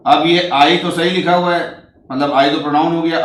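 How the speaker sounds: ordinary talk, nothing marked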